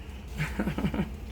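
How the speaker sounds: short breathy chuckle; quiet amused giggle; soft laugh. Real, not acted. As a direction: chuckle at the memory. Laughing at memory of being yelled at.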